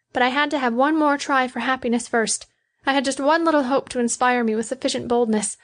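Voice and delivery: high-pitched woman's confession; reproachful, loving Betty. A narrator's reading.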